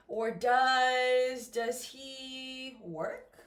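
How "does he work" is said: slow question, "work" sweeping upward; rising question intonation. Shown as a demonstration. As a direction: hold the words, then lift "work" like a plane taking off. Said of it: "It goes up like an airplane".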